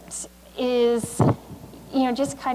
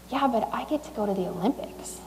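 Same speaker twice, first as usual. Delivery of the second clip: hushed, breathy retold answer; soft whispered comeback; whispered reply; 5 dB quieter than usual. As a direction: say it under your breath like a quiet secret. Retold reply to pitying parent.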